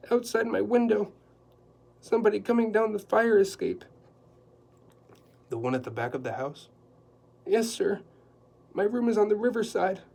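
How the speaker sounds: a high, loud, flustered housekeeper; anxious housekeeper. Acted. Housekeeper explaining the dinner to Quillerin, flustered and high. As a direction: rattle it off in a nervous flurry.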